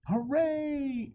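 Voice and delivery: high, loud joyful hooray; cheer. A narrator's reading. The whole court cheering the king's news, loud and high. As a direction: cheer like a happy crowd.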